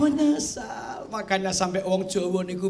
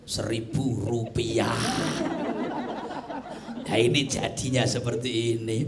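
much lower, deep angel voice; angel's voice; 12 semitones lower, 5 dB quieter than usual. Acted. Angel answering the dead person in the grave, deep and solemn. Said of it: "Kaget tuh malaikat".

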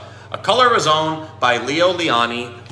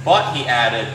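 gentle, measured picture-book narration with pauses for the pages; storybook reading. Acted. Shop owner reads to young viewers in storybook voice. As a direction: read it like story time for kids.

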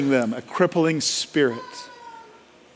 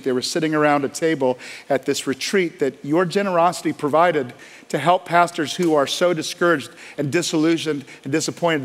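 ordinary talk

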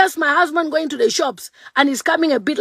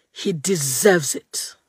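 low spiteful aside; 8 semitones lower, 6 dB quieter, slower than usual. Real, not acted.

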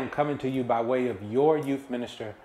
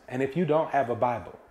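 ordinary talk, nothing marked

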